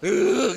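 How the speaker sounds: loud, high exasperated growl; mock growl of pent-up irritation; geraman geregetan. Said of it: "meskipun di sini geremet". (menggeram gemas dibuat-buat)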